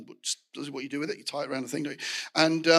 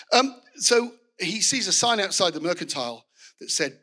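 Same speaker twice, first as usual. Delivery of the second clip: ordinary talk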